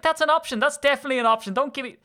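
high, loud mock enthusiasm; sarcastic retort. Retelling a clerk exchange, mocking sarcastic brightness. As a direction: brush off the offer with exaggerated cheer. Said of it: "I was like".

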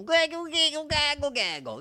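very high, loud squawking "Gaggle, gaggle"; cartoon gaggle voice. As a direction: squawk it like a silly cartoon bird. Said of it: "be in a cartoon and go".

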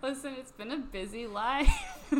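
mock excuse. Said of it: "we're like".

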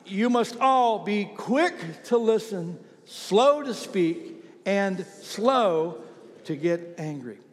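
very slow, each phrase weighed; slow scripture reading. Shown as a demonstration. Pastor reads James aloud to the congregation, slowly. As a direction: read it out slowly for the church. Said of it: "read it out loud".

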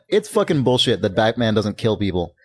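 ordinary delivery

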